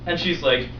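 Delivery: ordinary delivery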